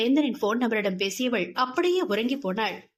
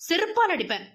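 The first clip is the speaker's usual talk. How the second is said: mock angry scolding; 7 semitones higher, faster than usual. Friend scolds angrily.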